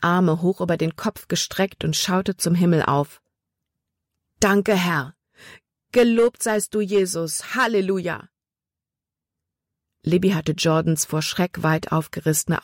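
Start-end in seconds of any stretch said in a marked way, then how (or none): 4.4-8.3 high, fervent thanks to heaven; grateful praise. A narrator's reading. Boy kneeling on the bank thanks God, fervent. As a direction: pour out relief to God.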